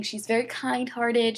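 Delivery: normal talking voice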